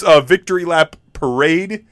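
ordinary delivery

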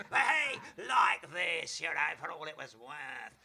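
hammed-up loud shout, very slow and stretched; over-the-top Ken impression. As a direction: throw his own voice back at him, full blast. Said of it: "I started to do him back at him".

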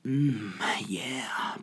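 soft, whispery mock pleasure; pervy dog. Dogs sniffing each other, whispery pervy murmur. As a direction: hum and whisper it, savouring. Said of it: "being like".

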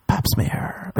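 unvoiced hushed punchline; whisper. Punchline to the girl, whisper. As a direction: breathe it out.

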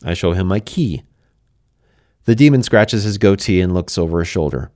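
plain reading voice

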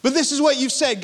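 ordinary talk

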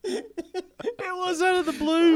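high, loud words through laughter; real laughing fit. Real, not acted. Host explaining his sudden tangent to co-host, laughing hard. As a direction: explain yourself while cracking up.

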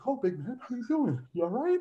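mocking high-pitched taunt; Candido's high voice. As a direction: sneer it up in a high falsetto-ish register. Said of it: "he had this real high-pitched voice".

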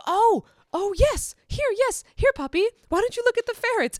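very high, loud cooing to a puppy; excited coaxing. Retold or imitated. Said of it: "And Kim goes".

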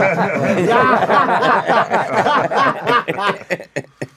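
a long, loud, high burst of laughter. Real, not acted. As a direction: laugh out loud in real delight. Bursts out laughing after accent joke.

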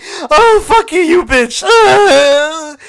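high, loud hammed-up wail; mock crying. Viewer cursing the film's tearjerker moment, mock bawling. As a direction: wail and curse through fake sobs. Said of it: "And I'm crying".

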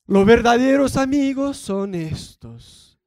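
lento, fuerte y teatral, como post resentido; indirecta dramática. Retold or imitated. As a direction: recitalo con dramatismo exagerado y voz más fuerte. Parodia de indirecta en internet. (parodiando una indirecta en redes, lento y fuerte)

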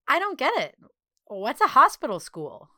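high, baffled question; puzzled. Acted. Puzzled child asks for explanation.

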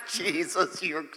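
low, soft panting relief; mock out-of-breath thanks, dropping low; acting winded, dying. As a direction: gasp it out as if spent from running. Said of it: "and I'm dying".

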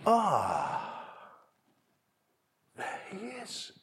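high breathy hushed rise on there he is; hushed delight at seeing him; spotting the son. Acted. Father spotting son far off.

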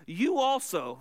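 put-on jab at "You also" like an accusing servant girl; mock accusation. Servant girl confronting Peter by the fire, accusing "You". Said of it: "It carries a very contemptuous, uh, tone".

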